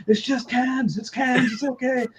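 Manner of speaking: high-pitched imitation of an amused reassurance; Keanu reassuring. Keanu to Sandra after hitting the cans, high mock reassurance. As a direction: pitch it way up, as if laughing off a false alarm. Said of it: "Keanu's, like, laughing, he's like".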